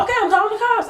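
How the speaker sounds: high, huffy mimicry of the neighbor; mock threat. Neighbor threatening to call the cops, high and huffy. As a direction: whine it like the petty neighbor.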